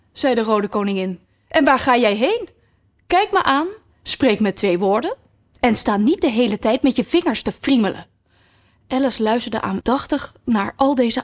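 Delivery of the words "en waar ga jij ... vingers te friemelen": high, commanding royal scolding; bossy red queen. Acted. Red queen scolds Alice about her manners, high and commanding.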